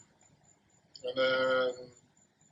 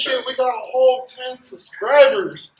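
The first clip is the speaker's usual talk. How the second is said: high, loud fake hype; hammed-up thrill over nothing; mock excitement; 9 semitones higher, 6 dB louder than usual. Mock celebrating ten subscribers.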